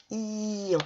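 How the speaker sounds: "ion" said as i-an, i held long; drawn-out I. Shown as a demonstration. Teacher naming the sound heard in triangle, i drawn out. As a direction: hold the i, then nasal an in "ion".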